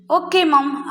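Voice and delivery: lightly voiced obedient kids; a hint of a child agreeing; children's reply. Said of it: "കുട്ടികൾ എന്ത് പറഞ്ഞു?".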